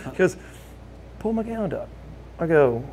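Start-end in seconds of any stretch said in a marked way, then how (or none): 1.2-1.9 insistent hushed request. Retold or imitated. Friend repeats confidential request, higher.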